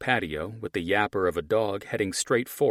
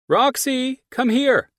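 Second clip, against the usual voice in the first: much higher, loud calling yell; neighbor yelling; 11 dB louder, slower than usual. Acted. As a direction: shout for the dog across the yard. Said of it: "a male voice yelled".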